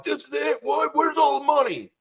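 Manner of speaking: high, panicky mock robber voice; robbers freaking out. Robbers finding only cash, high and panicky. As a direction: squeak it out like a startled thug. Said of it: "They're like".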